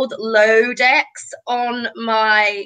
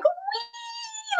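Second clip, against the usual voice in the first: high little squeal, very soft; a tiny thrilled whee; giddy mock squeal of joy; squeal of delight; 12 dB quieter than usual. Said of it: "I'm just like".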